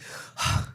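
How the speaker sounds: breathy voiceless exhale; audience sigh of relief. Retold or imitated. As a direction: let out a big audible breath. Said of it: "and everybody goes".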